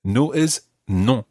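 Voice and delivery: slow, deliberate drill voice; slow teaching. Shown as a demonstration. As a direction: spell it out slowly. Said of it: "teaching drill".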